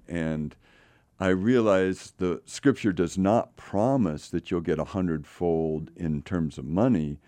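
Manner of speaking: usual speaking voice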